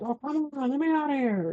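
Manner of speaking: high-pitched, held-back cry for release; mock scream. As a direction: wail it high without full volume. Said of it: "She screams like".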